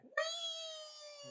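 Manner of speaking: high, thin mock squeal; imitated whee. Retelling a sky jump with the bird, imitated whee. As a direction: let out a soft, very high "whee".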